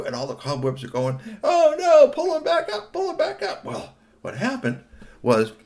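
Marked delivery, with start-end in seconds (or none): 1.4-3.7 very high, loud frantic cry; panicked squeak. Acted. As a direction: squeal for help. Cobwebs cry out.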